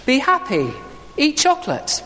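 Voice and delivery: very high, blithe slogan; chirpy Easter Bunny answer. Acted. Preacher voicing the Easter Bunny's answer to someone in pain, chirpy. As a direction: chirp the pat refrain way up high.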